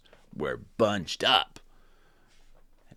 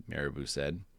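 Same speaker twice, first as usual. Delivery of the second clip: ordinary delivery, nothing marked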